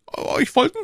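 very high, startled stammer. A narrator's reading. Prisoner ordered out at night, high alarmed stammer. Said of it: "stammelte Bonacieux".

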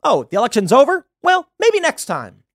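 imitating a court shrugging it off, rising high on the last words; mock dismissive court. The Supreme Court mocked as dismissing Trump's case, hammed-up and high. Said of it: "the Supreme Court will be like".

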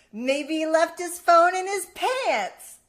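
loud, slow, high-pitched joke payoff; punchline delivery. Retold or imitated. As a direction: belt out the punchline, stretching every word. Retells her own joke's punchline.

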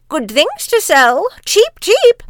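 high, loud hawker's cry; peddler crying wares. A narrator's reading. Disguised queen calls at the dwarves' door, loud and high. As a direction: call out wares like a market crone. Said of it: "and cried".

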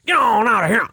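grandfather's dog call. Shown as a demonstration. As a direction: bark it at the dogs like grandpa. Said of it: "my dogs are on it".

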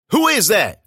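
sharp loud challenge into the dark; barked. A narrator's reading. Scared narrator barking at a stranger in the dark, loud. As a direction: bark it out, high and loud. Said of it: "I barked".

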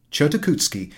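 plain reading voice

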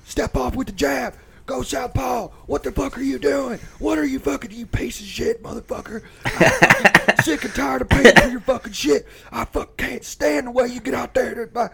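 high-pitched mock screaming tirade; livid dad. Livid dad yelling at his sparring son, hammed-up fury. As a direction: imitate a furious dad yelling from ringside. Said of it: "he is so fucking livid".

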